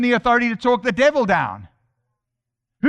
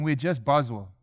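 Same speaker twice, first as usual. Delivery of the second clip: mock defeatism; 9 semitones lower, 6 dB quieter than usual. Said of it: "If I ever hear somebody saying".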